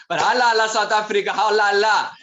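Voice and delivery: loud, high festive cheer. Real, not acted. Host cheering South Africa for the audience, loud and festive. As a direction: hype the crowd up like a stadium chant.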